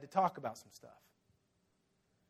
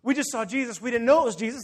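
raised, hurried retelling, acting winded; winded excitement; 5 semitones higher, 6 dB louder than usual. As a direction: burst in with big news. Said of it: "winded and they're like".

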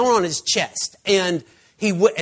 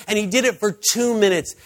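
normal talking voice

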